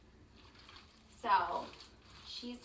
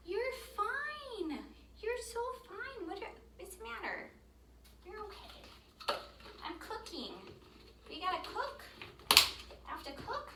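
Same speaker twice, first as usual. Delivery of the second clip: high, sing-song coo to a pet; 10 semitones higher than usual. Real, not acted. Owner cooing to her dog Coco while she cooks, high baby talk.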